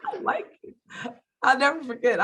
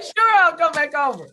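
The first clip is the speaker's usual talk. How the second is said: loud, high, happy imitation; eager agreement; 11 semitones higher, 9 dB louder than usual. Imitating him agreeing to return, loud eager reply. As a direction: jump at the invitation, pitched up. His eager answer acted out.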